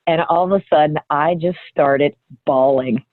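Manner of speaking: usual speaking voice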